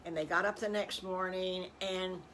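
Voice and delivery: ordinary delivery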